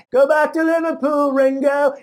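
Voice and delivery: loud, high mock heckle; heckling. Crowd jeering at the British pretty boy, heckling. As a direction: call it out high to jeer the singer. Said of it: "openly laughed and heckled this guy".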